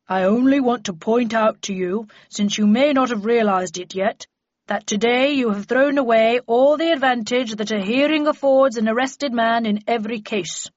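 a pompous court magistrate; deep-voiced magistrate. A narrator's reading. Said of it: "a deep voice said".

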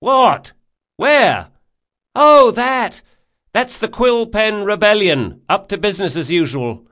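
high, loud, excitable rower; boatman character. Acted. Boatman telling his passenger about the rebellion, high and loud. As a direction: pipe up high and loud, like calling over the oars.

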